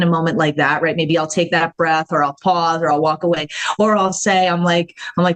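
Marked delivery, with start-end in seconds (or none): none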